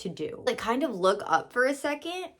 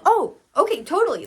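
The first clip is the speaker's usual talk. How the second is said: higher, eager, kind client voice; sweet client voice; 8 semitones higher, 3 dB louder than usual. Retold or imitated. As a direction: sound like a helpful client jumping in.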